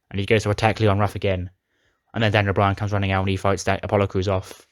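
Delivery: ordinary talk, nothing marked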